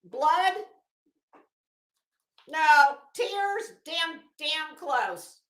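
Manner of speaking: ordinary delivery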